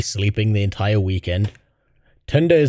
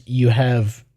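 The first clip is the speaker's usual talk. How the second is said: normal talking voice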